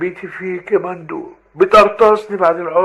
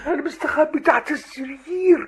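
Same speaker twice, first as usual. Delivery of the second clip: trembling voice; 8 semitones higher, 5 dB quieter than usual. Acted. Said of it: "صوتك بيترعش كده ليه".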